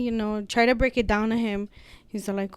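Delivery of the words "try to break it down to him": ordinary talk, nothing marked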